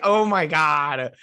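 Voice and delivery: loud, high, slow drawn-out reaction; exaggerated disbelief. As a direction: drag it out in mock amazement. Reacting to the bad French accent.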